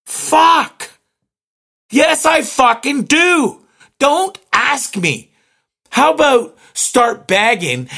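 high, slow, emphatic irritation; real exasperation. Real, not acted. Annoyed shopper answering the cashier's bag question, slow exasperated outburst. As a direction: spell it out slowly, fed up. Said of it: "I'm upset about this".

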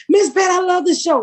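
high-pitched gushing fan. Retold or imitated.

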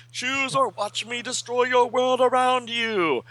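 high, hammed-up menace; villain threat. Villain Mordru threatening the teachers, high hammed-up yell. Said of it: "he yells".

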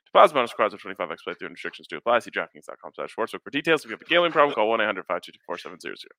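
rapid ad disclaimer read; fast legal disclaimer. Acted. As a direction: rattle off the fine print fast.

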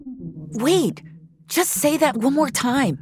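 urgent plea. A narrator's reading. Finch pleads as dream fades.

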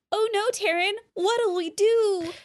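high helpless damsel plea; damsel in distress. Retold or imitated. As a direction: beg like a hammed-up helpless princess. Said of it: "damsel in distress role".

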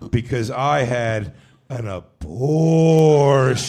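half speed, drawn out and deliberate; eerie slowed-down deadpan; spooky slow reveal. Retold or imitated. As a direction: drag every word out like a ghost story confession. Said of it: "she said it just like that".

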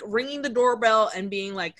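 normal talking voice

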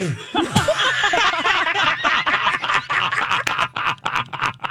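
high, long peal of laughter; long cackling laugh. Real, not acted. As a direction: burst into helpless giggles. Laughing at the child's retort.

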